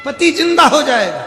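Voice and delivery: high, loud cry falling at the end; loud proclamation. Acted. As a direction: call it out for all to hear. Guru repeats promise far louder. (ऊँचे स्वर में पुकारकर)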